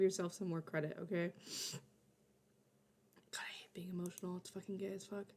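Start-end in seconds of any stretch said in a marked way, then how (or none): 3.3-5.2 soft quick whisper. Real, not acted. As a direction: whisper it to yourself, fast. Emotional muttered aside.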